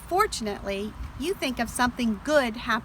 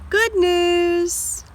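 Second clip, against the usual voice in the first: bright cheerful good news, pitched up; happy; 7 semitones higher, 3 dB louder than usual. Shown as a demonstration. Teacher reading to children, delighted. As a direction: cheer it like a gift.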